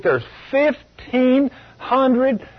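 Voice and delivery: FIFTEEN HUNDRED high and drawn out, syllable by syllable; emphatic figure. Real, not acted. Preacher citing a shocking count to church, "fifteen hundred" slow and emphatic. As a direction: spell out "fifteen hundred" slowly, with pauses.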